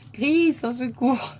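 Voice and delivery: high, loud, slow playful wail; a flustered, laughing plea for rescue; mock cry for help. Real, not acted. Embarrassed by her pseudonym on the card, a mock wail for help.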